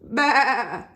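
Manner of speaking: wavering bleat; sheep's baa. Acted. Reader answers what the sheep says, wavering bleat. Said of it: "And the sheep?".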